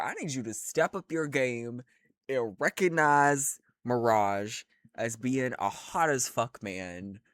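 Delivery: ordinary delivery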